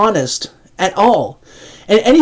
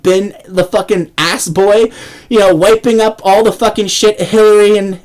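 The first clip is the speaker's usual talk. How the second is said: ordinary talk, nothing marked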